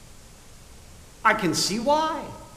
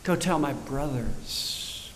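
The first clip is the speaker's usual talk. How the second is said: much softer and lower, slow; affectionate; 9 semitones lower, 7 dB quieter, slower than usual. Retold or imitated. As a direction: let the love show in it. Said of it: "A term of affection".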